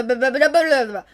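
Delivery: usual speaking voice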